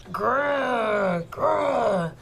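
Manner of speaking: drawn-out storybook bear growl. Shown as a demonstration. Teacher shows how her voice changes, bear growl. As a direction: growl out each Grr like a bear. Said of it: "how my voice changes".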